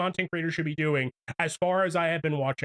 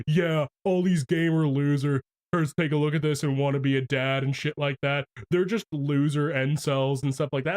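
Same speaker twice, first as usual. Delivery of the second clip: mocking a streamer; 6 semitones lower than usual. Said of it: "what he was saying was".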